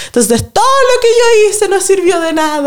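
mock whiny Baby. Mocking Baby complaining to Johnny: high whiny voice. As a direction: whine like a spoiled teen.

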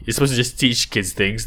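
ordinary delivery

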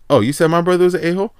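imitating an affronted girl, high, fast and loud; mock indignant confrontation. Girl confronting him over her brother, indignant. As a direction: throw the accusation back, high and quick.

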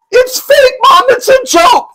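high, loud, near-crying shout; pleading yell. Retold or imitated. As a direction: yell it like a kid begging mom to get it. Kid pleading with mom.

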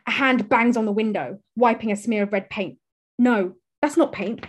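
usual speaking voice

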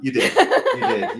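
high, loud proud cheer; excited praise. Real, not acted.

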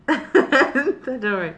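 slow, laughing reassurance; giggling through it. Real, not acted.

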